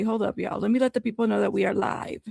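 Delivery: ordinary talk, nothing marked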